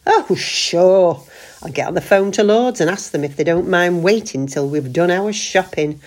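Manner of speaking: dripping with sarcasm; mock agreement, heavy irony; sarcastic. A narrator's reading. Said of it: "Cut out the sarcasm".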